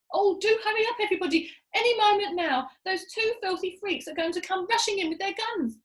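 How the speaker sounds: high, pressing hurry; anxious urging. A narrator's reading. Monkey father urging everyone to hurry, high and anxious.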